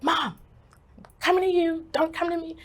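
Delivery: high, loud, insistent plea; urgent insistence. Retold or imitated. Daughter phoning mom after escaping the building, urgent insistence. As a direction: push the message out high and urgent. Repeats message more urgently to mother.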